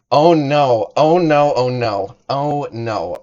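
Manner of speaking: loud, startled oh no; mock alarm at the redemption; a loud playful gasp of oh no; surprised oh no. Real, not acted. Sees channel point redemption.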